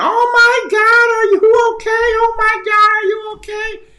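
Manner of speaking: shrieked way up high, panicky and slow; high-pitched old man scream. Retold or imitated. Telling the stream how the old driver shrieked, high and panicked. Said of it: "he's screaming".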